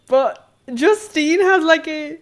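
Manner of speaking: high, loud drawn-out gushing. Real, not acted. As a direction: coo over something cute. Gushing over Justine's fringe.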